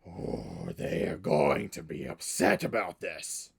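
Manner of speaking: low, growling orc menace; gruff Southern orc. Acted. GM as orc warning of trouble to come, low gruff growl. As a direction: drop down into a rough Southern growl. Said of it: "it's just gonna come out gruff".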